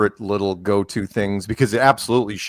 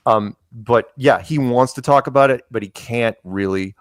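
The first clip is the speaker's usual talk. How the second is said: usual speaking voice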